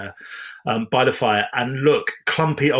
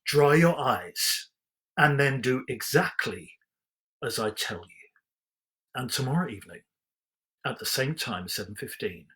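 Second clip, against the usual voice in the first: storybook reading voice, measured and even; story narration; 4 dB quieter than usual. A narrator's reading. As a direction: read it like a bedtime storybook. Story narration, bedtime close.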